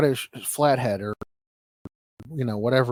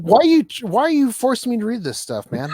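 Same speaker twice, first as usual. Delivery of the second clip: higher and louder, whiny protest; mock anguish; 10 semitones higher, 7 dB louder than usual. Real, not acted. Forced to read the article aloud, higher whiny protest. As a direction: complain like you're being tortured. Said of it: "This is torture for me".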